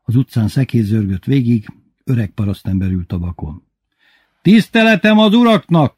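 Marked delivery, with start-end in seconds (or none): 4.4-6.0 high, loud hail; shouted greeting. A narrator's reading. Old farmer greeting the gentlemen from his cart, shouted greeting. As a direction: shout a respectful hello from the street. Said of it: "kiáltott be".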